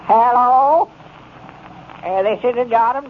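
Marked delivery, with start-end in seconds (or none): 0.1-0.9 drawn-out hello, rising at the end; raised, questioning telephone hello; answering the phone. Acted. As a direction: pick up and call down the line, higher. Answers the party-line phone, dreading news.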